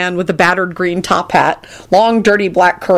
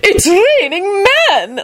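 very high, drawn-out whoop; delighted squeal; 14 semitones higher, much slower than usual. A narrator's reading. Old lady welcoming flying men, delighted squeal. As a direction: squeal it slowly with glee. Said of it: "Lady Fanshawe squealed".

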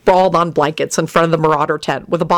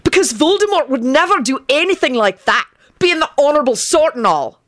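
high, sarcastic retort; exasperated, high-pitched sarcasm; shouted back; 9 semitones higher than usual. A narrator's reading. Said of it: "Benji shouted back".

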